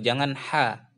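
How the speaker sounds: ordinary delivery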